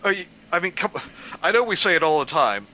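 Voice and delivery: ordinary talk, nothing marked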